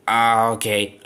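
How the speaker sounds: ordinary talk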